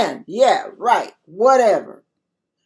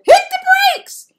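sudden high yell, much higher and louder; hollered warning; 4 dB louder than usual. Retold or imitated. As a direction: yell at the driver to brake now. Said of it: "I hollered".

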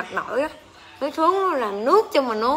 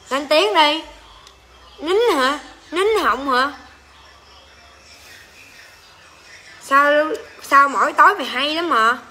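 higher, louder taunting; 3 semitones higher, 3 dB louder than usual. Real, not acted. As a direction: goad him to answer. Taunting silent boyfriend.

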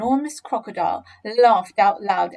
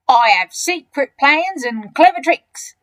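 loud, slow, higher boast; enormous crocodile, smug; 4 semitones higher, 10 dB louder than usual. Acted. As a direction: relish the scheme out loud.

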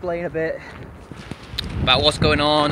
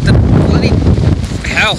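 wind on mic; 5 semitones higher, 8 dB louder, faster than usual. Real, not acted. Wind gust hits while filming.

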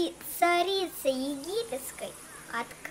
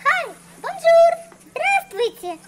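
much higher, much louder chirpy greeting; gushing caller; 12 semitones higher, 9 dB louder than usual. Acted. Tourist caller greeting the travel agent, gushing. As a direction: burst in with a sing-song hello.

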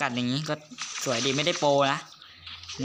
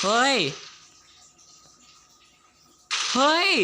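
sharp higher, louder shout; startled yell; 9 semitones higher, 3 dB louder than usual. Real, not acted. Gamer startled by a sudden attack, yelling. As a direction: yell out when caught off guard.

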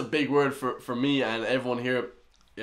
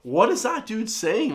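baffled; 8 semitones higher, slower than usual. Retold or imitated. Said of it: "I'm like".

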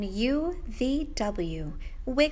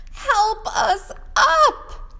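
slow, higher, louder plea; plaintive cry; 10 semitones higher, 8 dB louder, slower than usual. Acted. Letters A B C crying out to be helped up. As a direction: call for help from the pile.

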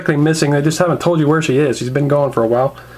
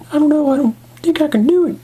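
high falsetto, hesitant; Michonne; 11 semitones higher than usual. Acted. Woman unsure about confessing her feelings, hesitant falsetto. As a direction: put on a high reluctant woman's voice. Michonne figure replies in skit.

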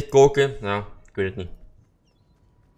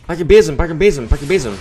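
louder, urgent shout to grab the broom; urgent calling out; 4 semitones higher, 8 dB louder, faster than usual. Real, not acted. Gamer urging his teammate to grab the broom, loud and urgent. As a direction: yell it at your teammate to hurry.

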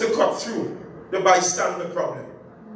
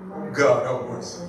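breathy plea; 10 semitones lower than usual. Real, not acted. Preacher's breathy aside to God.

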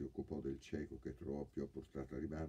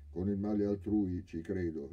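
scornful; 4 semitones higher, 8 dB louder than usual. A narrator's reading. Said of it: "rispose il ladro sdegnoso".

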